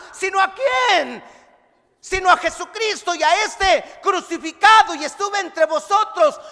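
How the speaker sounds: loud, emphatic sermon; fervent preacher quoting Paul; preaching. Acted. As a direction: proclaim the verse to the congregation. Preaching with Scripture quote.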